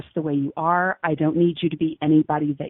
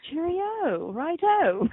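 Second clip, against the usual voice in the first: high mock English accent; chirpy English accent put on; 10 semitones higher, much slower than usual. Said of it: "have an English accent".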